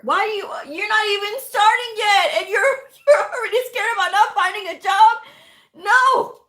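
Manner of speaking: can't believe they're already scared; exasperated viewer reply; exasperated disbelief. Real, not acted.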